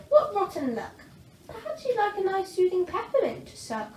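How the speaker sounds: put-on sweet young girl; little girl. A narrator's reading. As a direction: sympathise gently. Girl sympathises.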